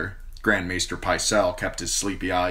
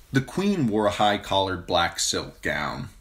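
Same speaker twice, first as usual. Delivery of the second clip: plain reading voice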